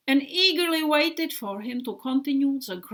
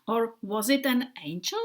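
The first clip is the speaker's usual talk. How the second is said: usual speaking voice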